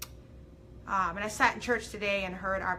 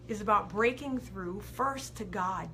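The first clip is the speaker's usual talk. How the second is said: usual speaking voice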